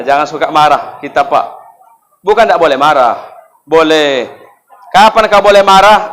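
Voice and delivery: ordinary talk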